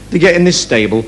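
normal talking voice

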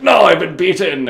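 loud, high-pitched defeated wail; anguished mock cry. Villain crying out as he is beaten, mock anguish. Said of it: "Marlux's like".

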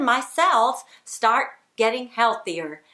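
ordinary talk, nothing marked

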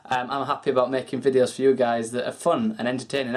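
normal talking voice

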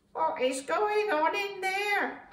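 high, loud, drawn-out squawk; curious birds. A narrator's reading. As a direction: squawk the question slowly, way up high. Birds wonder about the noise.

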